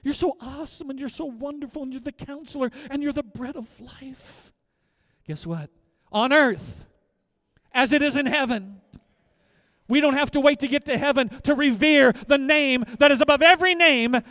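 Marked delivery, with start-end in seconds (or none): 0.0-4.5 high, pleading adoration; crying out to God. Acted. Preacher acting believers bowed before the Lord, pleading cry. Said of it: "we're gonna cry out to him". 6.1-14.2 high, loud proclamation; full-force preaching. Real, not acted. Preacher urging church to revere the name now, shouting.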